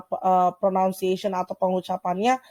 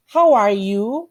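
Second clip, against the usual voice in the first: slow model reading; 3 dB louder, much slower than usual. Shown as a demonstration. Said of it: "teacher models greeting".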